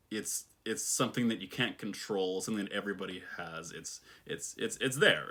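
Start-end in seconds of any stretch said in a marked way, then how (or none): 3.7-4.5 voiceless, much softer stammer; whispered falter. Real, not acted. Searching for words to viewers, whispered falter. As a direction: whisper the false start.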